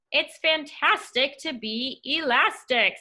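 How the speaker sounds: slow, elastic, drawn-out words; a slow, whiny stretchy voice; stretched-out slow voice. Acted. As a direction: drag the words like stretching rubber. Stretchy character, slowed voice.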